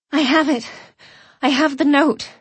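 high, loud urgent announcement; urgent excitement. Acted. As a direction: blurt it out breathless. Breathless urgency at detective.

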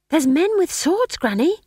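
put-on high, loud child's cry; excited child. A narrator's reading. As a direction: call out like an excited kid. Child calling out to Granny.

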